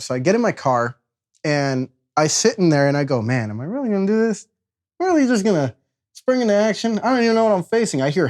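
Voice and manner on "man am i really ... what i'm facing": nervous self-talk. Retold or imitated. Nervous self-talk before rescue.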